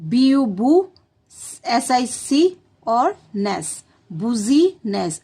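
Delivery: letters spelt slowly, then बुजीनेस as "bu-zee-ness"; slow spelling out. Shown as a demonstration. Teacher spells business for children, slow and clear. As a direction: sound out each chunk slowly. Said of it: "याद कराना है बुजीनेस".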